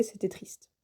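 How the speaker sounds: breathy dramatic whisper. Acted. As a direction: drop to a whisper for effect. Dramatic aside about sad anime.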